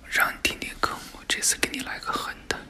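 hushed, unvoiced scheming aside; secretive whisper to yourself. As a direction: whisper it privately, plotting. Plotting aside to himself.

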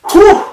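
high, loud blown-out whew; gasping whew after a hard set; relieved whew. Real, not acted. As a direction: let the breath burst out after effort. Exhale after a finished set.

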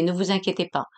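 usual speaking voice

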